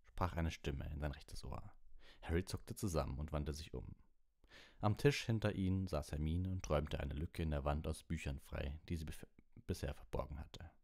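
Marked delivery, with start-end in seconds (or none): none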